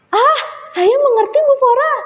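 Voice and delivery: high, eager exclamation; sudden realisation. A narrator's reading. Kagau tells Bu Fora the lesson is understood, excited. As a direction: burst out high as it clicks.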